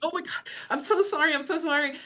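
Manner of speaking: retold panicked apology. Retelling how she apologized to the webinar audience, mock panicked. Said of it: "I'm panicked and sweating".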